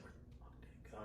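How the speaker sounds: whispered mock panic; imitating suppressed panic. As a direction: hiss it under your breath, imitating someone hiding panic. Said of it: "He's trying not to panic in front of us".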